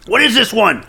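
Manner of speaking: ordinary talk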